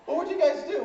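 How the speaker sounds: high, loud mom voice; inquiring mom. Acted. Mom asking son about the trip, high voice. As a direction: ask it high and brightly as mom.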